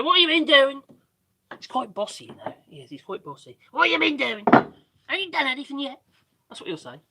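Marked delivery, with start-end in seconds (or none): 0.0-0.8 higher, louder bossy mock question; imitating Graham. Mock bossy Graham checking on the work. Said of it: "Graham's gonna say". 3.7-6.0 put-on Scouse accent. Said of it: "In his Scousey accent".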